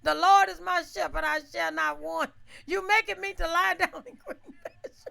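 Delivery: loud, high, half-laughing Psalm recital; hurried shouted psalm; frantic prayer. Retold or imitated. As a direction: pray it out loud in a panic. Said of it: "I started quoting the twenty-third Psalm".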